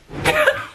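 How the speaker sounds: squealing laugh; sudden high-pitched giggle; high burst of laughter. Real, not acted. Laughing at friend's entrance. (éclat de rire aigu)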